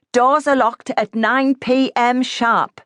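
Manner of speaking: slow, high stern call; called back. A narrator's reading. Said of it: "the voice called back".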